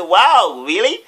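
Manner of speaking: loud, high "wow" rising into a question; mock amazement. Scammer hearing his friends got visas, acted amazement. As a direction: act out a big surprised reaction. Feigning surprise at the visa news.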